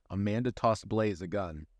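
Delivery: plain reading voice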